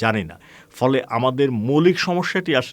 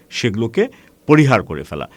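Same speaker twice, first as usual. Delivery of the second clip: normal talking voice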